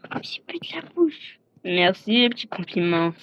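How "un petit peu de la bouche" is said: high whispered aside; sneaky whispered aside. Acted. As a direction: breathe it as a secret.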